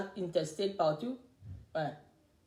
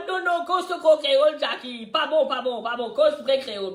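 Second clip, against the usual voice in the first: mock scolding, louder and higher; imitating husband's correction; 4 semitones higher, 6 dB louder than usual. Husband scolding her creole pronunciation, mock loud. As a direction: play the husband telling her it's no good. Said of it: "il criait".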